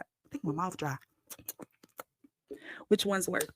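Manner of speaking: usual speaking voice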